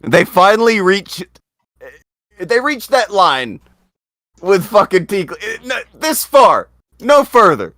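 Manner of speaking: high, loud, slow "this far, no further"; indignant shout. Real, not acted. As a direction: declare the line, furious. Ranting, drawing the line.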